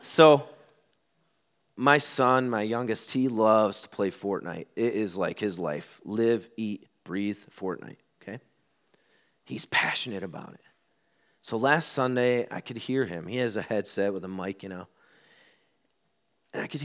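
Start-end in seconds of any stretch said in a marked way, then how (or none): none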